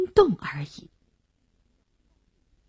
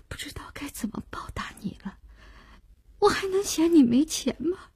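soft, tearful, high-pitched voice catching; choked with sobs; 4 semitones higher, 7 dB quieter than usual. Acted. Girlfriend clinging to Wu Xiao as she confesses, choked up. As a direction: fight through tears, voice thinning and breaking into whispers. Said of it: "哽咽着说".

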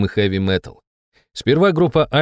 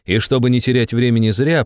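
ordinary delivery, nothing marked

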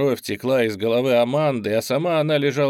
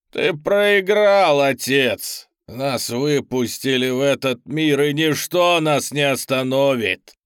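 demon roar; 5 dB louder, slower than usual. Acted. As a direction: roar it out in triumph. Demon gloats from the ground. (ликующий рёв демона)

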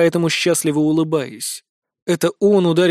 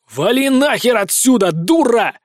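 loud howl, higher pitched; 5 semitones higher, 3 dB louder than usual. Acted. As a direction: howl at her to get lost. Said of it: "вою".

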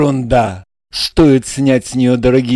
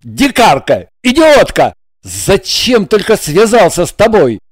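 loud, higher furious curses; furious yelling; 6 semitones higher, 4 dB louder than usual. A narrator's reading. Igor yells curses after Regina in the street, loud and furious. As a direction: yell the insults at full voice. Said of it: "орал свои ругательства во весь голос".

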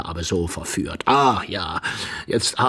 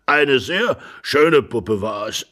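put-on slow old man's voice; doddering old husband; 4 semitones higher, 3 dB louder, slower than usual. Old husband carries on about the doll, doddering. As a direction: drawl it fondly as an old man.

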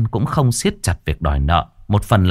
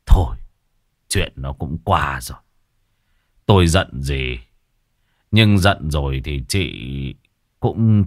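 weary resignation; 4 semitones lower than usual. Acted. Brother-in-law offering shelter for the night, wearily resigned. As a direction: let the anger go, speaking low with pauses. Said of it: "Ông Toàn thở dài".

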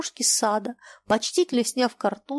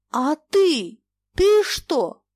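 higher and louder, building rage; rising fury; 7 semitones higher, 5 dB louder, much slower than usual. Acted. Old master berating the captain, rising fury. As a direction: climb into fury.

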